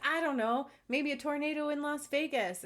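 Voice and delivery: playful quip. Retold or imitated. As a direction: toss off a joke answer, bright. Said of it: "And Chanel was like".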